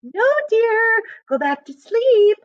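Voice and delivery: lightly voiced woman calling out half asleep; mild sleepy call; sleepy wife. A narrator's reading. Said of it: "called Allison".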